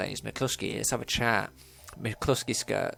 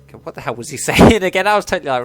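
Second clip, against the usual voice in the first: high, loud, amused at oneself; laughing; 6 semitones higher, 15 dB louder, much faster than usual. Real, not acted. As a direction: talk through a burst of laughter. Cracks up after own accent.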